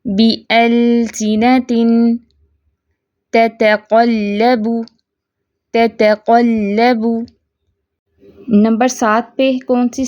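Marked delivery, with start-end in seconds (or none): 0.0-2.2 बिअलसिनतिन very slow, with sustained steady l; medium-flow lam. Shown as a demonstration. Teacher repeats correctly after explaining, slow steady lam. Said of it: "आवाज़ दरमियानी कैफियत में चलेगी". 3.3-7.2 slow, measured recitation; slow tajweed demo. Shown as a demonstration. Teacher models an example of lam, slow recitation.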